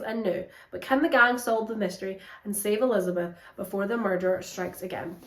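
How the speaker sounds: soft, measured back-cover read; blurb read. Acted. Booktuber reading the back cover, soft trailer voice. Reads the book blurb aloud.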